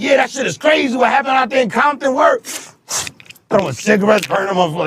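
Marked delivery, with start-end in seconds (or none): 0.0-2.4 high, rowdy yell; drunken shout. Retold or imitated. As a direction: drunk yelling at Nipsey in the studio. Drunk him shouting at Nipsey.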